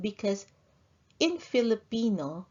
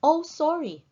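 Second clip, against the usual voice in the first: much higher, louder "Oh, sorry" falling sharply; startled apology; 9 semitones higher, 5 dB louder than usual. Acted. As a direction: gasp out the apology, caught out. Acted apology in dialogue example.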